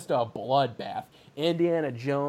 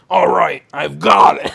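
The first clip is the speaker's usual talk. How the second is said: louder, rasped hero line; gruff hero redo; 3 semitones higher, 10 dB louder than usual. Shown as a demonstration. Retaking Indiana Jones's line louder and rasping. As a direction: bark it out like a rugged hero. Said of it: "oh wait, sorry".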